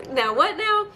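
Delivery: high, stunned disbelief; incredulous. Retold or imitated. Viewer reacting to the TV, high and stunned. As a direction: squeak out the shock. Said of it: "I was looking at the T V screen like".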